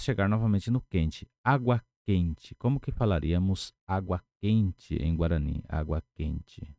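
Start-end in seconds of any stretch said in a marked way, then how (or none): none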